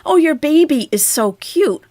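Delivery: gushing, high and loud; example compliment. Pretend. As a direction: coo over someone's baby, acted. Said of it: "When you compliment someone".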